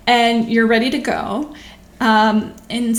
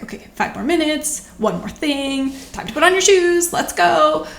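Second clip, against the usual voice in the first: imitating a parent coaxing, rising higher and louder toward the end; mock cajoling parent, more insistent on the shoes; 6 semitones higher, 3 dB louder than usual. Parent at the museum coaxing a toddler to leave, mildly insistent.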